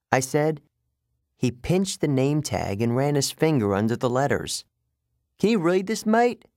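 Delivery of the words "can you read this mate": put-on high, British-like accent, condescending question; strange British accent. A narrator's reading. Guard tests the boy on his name tag, strange British accent. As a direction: quiz them in a high, odd British voice. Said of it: "He had a strange accent".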